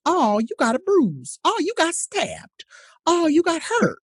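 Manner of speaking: high, slow, hollow sympathy; mock ally pity. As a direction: shrug it off with fake concern. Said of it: "standing there going".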